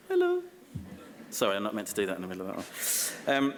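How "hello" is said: high-pitched hello. Real, not acted. Greets heckling child in audience.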